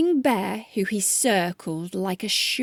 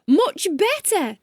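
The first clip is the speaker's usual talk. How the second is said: higher pleased exclaim; greedy exclaim; 7 semitones higher than usual. Acted. Chameleon admiring painted spots, pleased exclaim. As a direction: crow over the sale. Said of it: "the greedy chameleon exclaimed".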